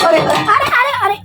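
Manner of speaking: high, sudden alarmed अरे अरे; startled cries. Real, not acted. Flipping the Maggi into the bowl, high startled cries. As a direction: yelp as the food slides away.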